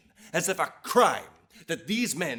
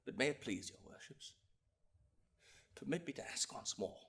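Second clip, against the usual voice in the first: soft, low, measured appeal; subdued courtroom pleading; grave plea; 3 semitones lower, 12 dB quieter than usual. Acted. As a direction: bring it down to a sorrowful hush. Grave plea for persecuted descendants.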